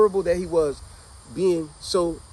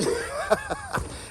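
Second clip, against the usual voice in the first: high mocking laugh; 4 dB quieter than usual.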